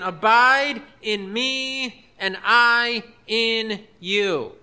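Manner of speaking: very slow, drawn-out scripture reading; slow solemn quotation. Acted. Preacher quoting the Lord's words to congregation, very slow and deliberate. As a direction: stretch out each phrase of the verse. Reading the key verse emphatically.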